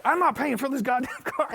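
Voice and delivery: high, loud mock outrage; freaking out. Drummer refusing to pay the car service, comically freaking out. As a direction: shriek it like an indignant drummer, hammed up. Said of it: "He's freaking out".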